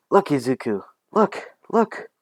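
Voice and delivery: put-on high-pitched eager child calling a friend to look; eager, rising-pitched excitement; excited showing off. Child shows off new quirk.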